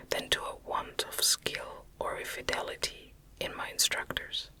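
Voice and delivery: ordinary delivery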